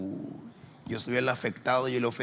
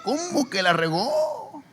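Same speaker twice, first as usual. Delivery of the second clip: higher, louder, slow disbelief; surprised; 6 dB louder, slower than usual. Retold or imitated. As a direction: react in shock. Pastor reacts.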